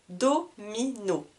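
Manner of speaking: "Domino" slowly in three syllables; cut into syllables. Shown as a demonstration. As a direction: separate each syllable of "Domino". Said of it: "Trois syllabes". (« Domino » lentement, en trois syllabes)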